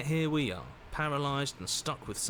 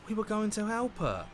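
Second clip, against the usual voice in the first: much higher, outraged why; indignant disbelief; 12 semitones higher than usual. Acted. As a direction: demand why, stunned. Character outraged at betrayal.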